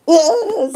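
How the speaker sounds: a burst of loud high laughter; loud cackle. Real, not acted. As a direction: crack up out loud. Laughs loudly.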